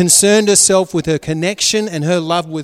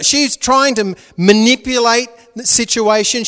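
ordinary talk, nothing marked

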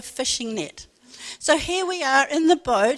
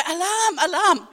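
higher, louder mock warning cry; alarm call; 5 semitones higher, 4 dB louder than usual. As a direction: call out a retold boat alarm. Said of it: "you've got to say, "Alarm, alarm".

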